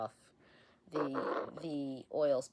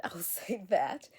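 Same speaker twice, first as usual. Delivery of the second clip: whispered laugh; 3 semitones higher, 5 dB louder than usual. Real, not acted. Laughing aside about her voice.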